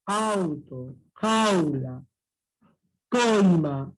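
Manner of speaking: "Auto", "Jaula", "Coima" each stretched on the first vowel, then falling; stress on open vowel. Shown as a demonstration. Teacher giving decreasing diphthong examples, stress on open vowel. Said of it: "con mayor fuerza de voz".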